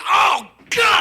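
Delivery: high, loud wincing shout; mock horrified cry. Everyone sees Iggy fall, horrified shout. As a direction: cry out like a crowd watching a bad fall.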